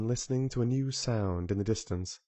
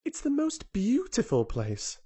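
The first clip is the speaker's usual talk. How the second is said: much higher, indignant protest; 14 semitones higher, slower than usual. A narrator's reading. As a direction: defend it as if insulted. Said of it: "protested Mary".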